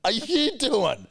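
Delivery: slow, very high retold disbelief; shocked outburst. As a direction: stretch out an acted, incredulous yell. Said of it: "And I'm so shocked".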